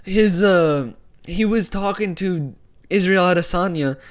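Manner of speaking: usual speaking voice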